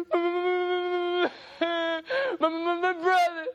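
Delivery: put-on high, tearful little girl voice; crying little girl. Little girl crying to her dad about her brother. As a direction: stammer it out like a sobbing child.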